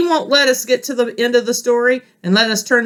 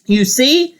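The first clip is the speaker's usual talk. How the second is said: plain reading voice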